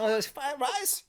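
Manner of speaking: high, loud, slow mock Vietnamese accent; Vietnamese vendor accent. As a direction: call a customer over in a hammed-up Vietnamese accent. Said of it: "and they're like".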